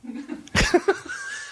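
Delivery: breath-heavy wheezing laughter; helpless laugh. Real, not acted. Comedian cracking up at an album name, wheezing laughter. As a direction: crack up until little voice is left.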